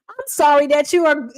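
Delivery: normal talking voice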